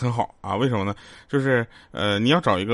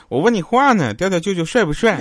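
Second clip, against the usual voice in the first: higher, louder pushy question; insistent teasing; 7 semitones higher, 3 dB louder than usual. Retold or imitated. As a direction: push her for an answer, playfully. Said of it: "我又撩他".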